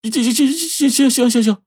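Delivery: high, quavering terrified stammer; trembling with fear. A narrator's reading. Wang Mao to the robbers, stammering in terror. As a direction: stammer yes over and over, shaking with fear. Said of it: "嘴里吐出这话是战战兢兢的".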